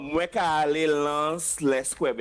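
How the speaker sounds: slow patois sentence "Mwen ka ale Lance Les Cuev"; teacher giving a patois example sentence. Shown as a demonstration. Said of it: "You see in Patois".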